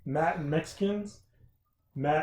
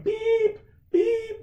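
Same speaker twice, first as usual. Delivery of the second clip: high squeaky beep like a cart horn; mock beep; 6 dB louder than usual. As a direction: imitate a toy horn, way up high. Imitating cart horn beeping.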